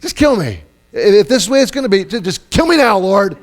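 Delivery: high, loud, hammed-up exasperation; over-dramatic fed-up outburst; smart-aleck Moses. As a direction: beg God with mock melodrama. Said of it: "He's just being a real smart aleck".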